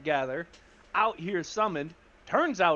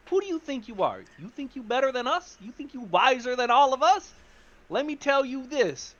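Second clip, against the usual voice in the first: higher-pitched challenging questions; indignant confrontation; 6 semitones higher than usual. Acted. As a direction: square up to someone acting superior. Acted confrontation of a party member.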